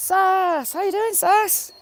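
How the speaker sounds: high, loud hello, name drawn out; excited greeting. Real, not acted. Vlogger hails a regular viewer joining the stream, excited greeting. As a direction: call out delightedly to a friend who just arrived.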